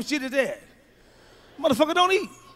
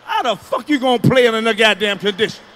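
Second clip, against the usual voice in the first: usual speaking voice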